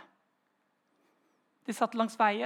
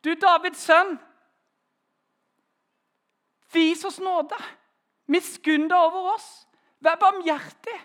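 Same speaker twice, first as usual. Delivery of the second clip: higher, louder desperate plea; crying out louder; 6 semitones higher, 7 dB louder than usual. Retold or imitated. Blind men ignoring the disciples, pleading louder still. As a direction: shout over those hushing you. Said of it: "Så ropte de bare enda høyere".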